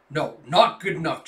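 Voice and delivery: loud sharp interruption; angry cut-off. Acted. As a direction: shut his excuse down hard. Commander cutting off excuse.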